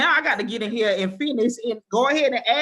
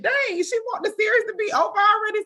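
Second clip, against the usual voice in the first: much higher and louder, hammed-up disbelief; playfully outraged at the note; mock indignant surprise; 13 semitones higher, 6 dB louder than usual. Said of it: "And I said".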